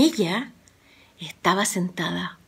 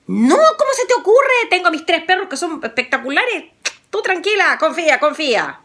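much higher, louder boastful reassurance; confident young hero; 9 semitones higher, 3 dB louder than usual. Acted. Juan reassures Rosa, higher and confident. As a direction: brush off the danger cheerfully.

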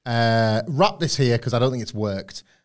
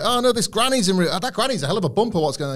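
much higher granny voice, flustered; 7 semitones higher, faster than usual. Acted. Stunt granny in the ring, high flustered granny voice. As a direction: squeak like a startled old lady. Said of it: "the granny could be like".

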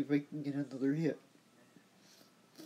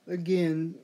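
ordinary talk

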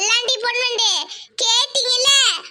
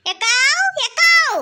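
loud call rising, then higher; 4 semitones higher, 4 dB louder, much slower than usual. Acted. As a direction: holler for big sister. Calling out for elder sister. (அக்காவை உரக்கக் கூப்பிடுதல்)

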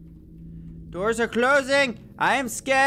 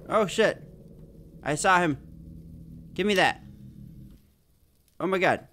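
normal talking voice

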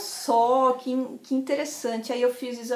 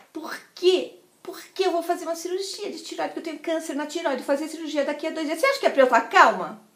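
higher, rapid indignant retort; quarrelling; 4 semitones higher, faster than usual. Retold or imitated. Tells friend of surgery in two days, indignant. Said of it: "Eu brinquei com ela".

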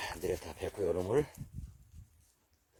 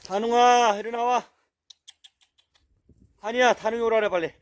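high, loud coaxing call to a dog; 10 semitones higher, 7 dB louder than usual. Real, not acted. Owner calling his hiding dog to come out, loud and high. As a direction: coax the hiding dog out from afar.

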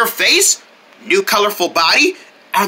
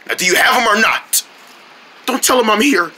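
normal talking voice

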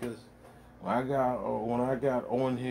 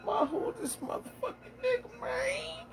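high squeaky voice of a startled stranger; 5 dB quieter than usual. Retold or imitated. Startled stranger asks who this old guy is, in a high squeaky mock voice. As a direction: squeal it out, much higher, like someone spooked. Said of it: "He's like".

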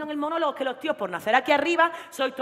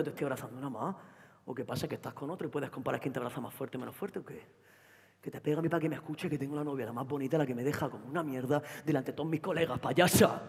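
put-on jealous boyfriend; 9 semitones lower, 5 dB quieter than usual. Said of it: "novio Antonio celoso".

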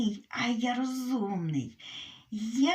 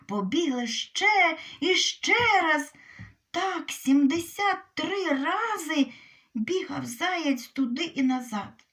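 ordinary delivery, nothing marked

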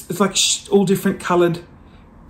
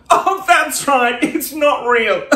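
higher, slow, laughing; laughing realisation; 5 semitones higher, slower than usual. Pretend. Vlogger joking about fake ice cream, laughing. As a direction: pretend to remember it's fake.

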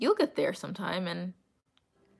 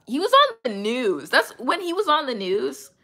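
higher and louder, bursting with excitement; excited; 6 semitones higher, 7 dB louder than usual. Real, not acted. Recalling him on the news, excited and louder. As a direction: gush about him being on the news.

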